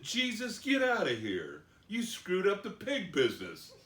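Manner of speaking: high, indignant mock complaint; hammed-up outraged ejection; angry townspeople. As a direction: drive him off like an aggrieved pig farmer. Acting angry townspeople rejecting Jesus.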